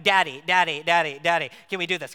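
put-on loud, insistent little-girl nagging; imitating a persistent toddler; nagging child. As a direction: pester daddy over and over, loud. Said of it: "it's like".

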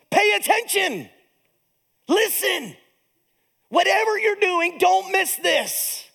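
high-pitched, emphatic herald's call; urgent proclamation. Acted. Pastor proclaiming what the angels' "hark" means, urgent high call. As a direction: call it out high, stretching the final command. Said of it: "They are proclaiming an important message".

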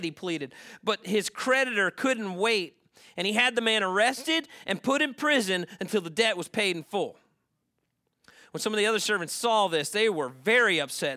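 ringing dramatic Bible storytelling; forceful scripture reading. A narrator's reading. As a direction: drive the parable forward at full voice. Reads the parable with force.

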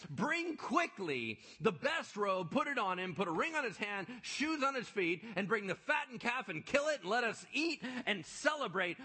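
loud, high preached Bible reading; preaching scripture. Acted. Preacher reading the father's joy in Luke, full force. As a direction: proclaim it to the congregation.

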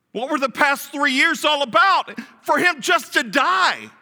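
shouted, high despairing question; anguished cry. Acted. Preacher shouting the disciples' despair, anguished. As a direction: cry out the disciples' confusion to the congregation.

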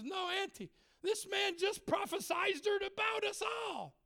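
put-on excited boy. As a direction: shout it out like a thrilled kid. Excited boy calling to aunt.

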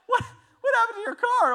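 laughing high in disbelief. Retold or imitated. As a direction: laugh through the question. Friend voiced, laughing.

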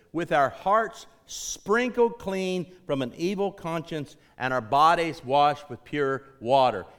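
emphatic pulpit recitation; preached scripture reading. Acted. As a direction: proclaim the verse, leaning on each key word.

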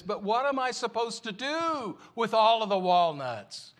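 calls out high and loud after him; a boy yelling after the departing old man. Acted. Boy yells out after the wise man, high and loud. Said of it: "the third boy yells out to him".

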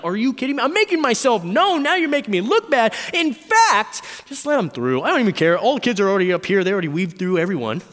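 loud, high exasperated rant; annoyed outburst rushing on at the end; angry, indignant. Acted. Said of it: "angry, like".

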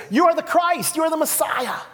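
eager disciple blurting the answer; piped up. Retold or imitated. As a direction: blurt it out eagerly, pitched high. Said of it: "he piped up as he always does".

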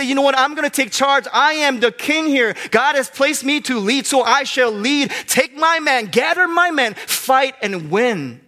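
high, loud, commanding king declaring he'll lead, slowing on fight and win; King David taking charge. Retold or imitated. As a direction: proclaim like a king rallying his men. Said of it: "he said".

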